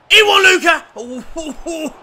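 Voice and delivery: high, loud burst of delight; thrilled fan yell; excited shout. Real, not acted. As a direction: shout his name in triumph. Fan celebrating a won ball.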